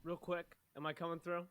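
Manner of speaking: faint, distant voice; talking from across the room; far from mic. Real, not acted. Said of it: "you sound like you're far away".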